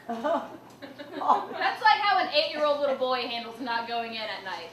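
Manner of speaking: usual speaking voice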